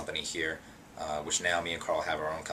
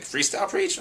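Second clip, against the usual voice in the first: eager; 6 dB louder than usual. Retold or imitated. Kev eager question.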